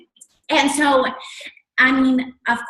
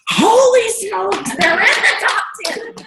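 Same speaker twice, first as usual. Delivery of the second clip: laughing, high excited exclamation; excited disbelief; 11 semitones higher, 5 dB louder, slower than usual. Retold or imitated. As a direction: squeal it like you can't believe the news. Team hears ranking announced.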